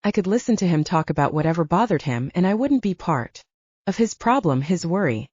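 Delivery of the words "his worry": usual speaking voice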